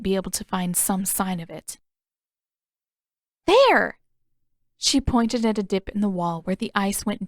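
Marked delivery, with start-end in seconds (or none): 3.5-4.0 triumphant discovery. Acted. Katara spots the mark.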